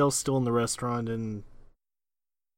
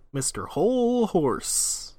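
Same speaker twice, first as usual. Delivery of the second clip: grand announcement; 7 semitones higher, slower than usual. Pretend. Announcing favorite villain.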